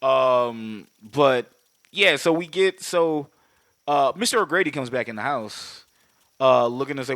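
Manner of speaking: normal talking voice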